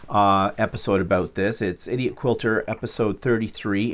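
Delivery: usual speaking voice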